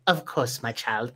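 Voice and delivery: soft, low, tender murmur; a hint of a tender witch. Witch soothing the small mushroom creature, softly. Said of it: "picks you up and goes".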